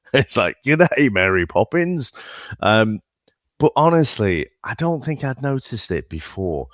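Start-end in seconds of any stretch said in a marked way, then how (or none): 0.7-2.1 drawn-out, higher mock Australian-Cockney accent.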